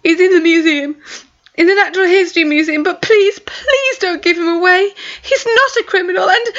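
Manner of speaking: high, rushed desperate begging; desperate pleading. Acted. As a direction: blurt it out and beg. Maya confessing, begging.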